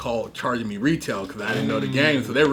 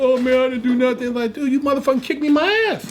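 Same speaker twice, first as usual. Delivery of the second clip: excited retold exchange, higher and laughing; higher mock quote; 10 semitones higher than usual. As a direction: play both sides of the argument, laughing. Quoting courtroom exchange.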